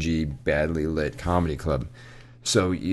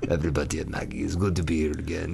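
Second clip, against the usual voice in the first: put-on softer, lightly Russian-accented sign-off; old Russian lady; 2 semitones lower, 7 dB quieter than usual. Old Russian comic greeting the regulars, soft Russian accent. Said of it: "this old Russian lady".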